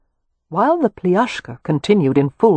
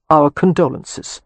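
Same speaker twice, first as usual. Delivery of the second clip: ordinary delivery, nothing marked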